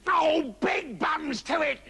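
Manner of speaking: high, drawn-out wolf shout. A narrator's reading. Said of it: "said the wolf".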